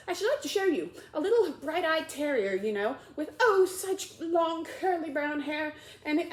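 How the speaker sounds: high, bright gushing voice; excited chatter. Acted. Alice gushing to the mouse about a dog, excited. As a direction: gush about the little dog.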